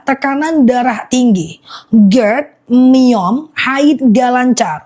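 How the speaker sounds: slow and deliberate, word by word; slowly repeated. Shown as a demonstration. Live seller repeating the list for viewers, slowly. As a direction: spell out each ailment slowly for the viewers. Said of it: "Aku ulang pelan-pelan ya".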